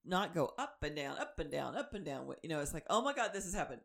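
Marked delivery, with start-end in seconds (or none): 2.9-3.8 high-pitched overreacting outburst; mock panic. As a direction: play up a flustered freak-out.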